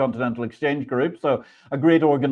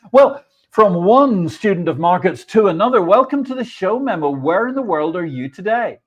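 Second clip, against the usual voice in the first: higher, louder host greeting, bright and eager; upbeat show welcome; 5 semitones higher, 5 dB louder than usual. Real, not acted. Host greeting his guest on air, higher and louder.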